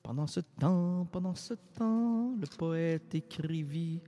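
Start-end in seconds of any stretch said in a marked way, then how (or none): none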